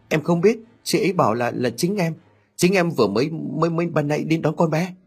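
high, stammering bewilderment; panicked. A narrator's reading. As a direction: stumble and repeat words in mild panic. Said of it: "Huệ hoảng đến mức đánh rơi". (lắp bắp hoang mang)

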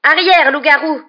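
slow, high, very loud cry; panicked stammer. A narrator's reading. Injured boy warning off the werewolf, alarmed shout. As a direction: shout to ward off a monster. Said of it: "Ron balbutia".

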